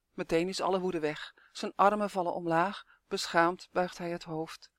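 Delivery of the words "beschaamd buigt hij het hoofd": ordinary delivery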